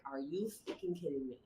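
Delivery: low, soft incredulous question; hushed disbelief. Retold or imitated. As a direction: ask it quietly, appalled. Disbelief as first person quits.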